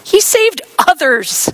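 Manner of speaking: high, loud, slow scoff. Acted. Priests scoffing at Jesus, high sneering voice. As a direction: sneer at his failure. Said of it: "they scoffed".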